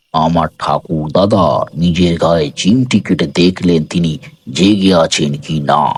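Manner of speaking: ordinary delivery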